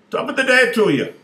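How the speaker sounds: squeaky high little-man greeting, loud and bright; leprechaun voice. Acted. Wee leprechaun greets the man at the urinal, high and loud. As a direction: pitch way up like a tiny leprechaun.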